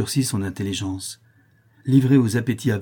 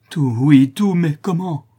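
loud, high, urgent assent; desperate eagerness; 6 semitones higher, 8 dB louder, slower than usual. A narrator's reading. Notary promising Cécilie everything, desperately eager. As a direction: burst out, ready for anything.

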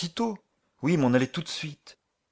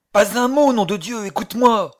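loud, high urgent command; urgent shout; 8 semitones higher, 7 dB louder than usual. Acted. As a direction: cut her off with a shout. Urgent orders over the phone.

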